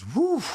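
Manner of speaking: imitated high, rising whew; retold whew. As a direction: let out a high whew like the other man. Retold reaction of a listener, exhaled.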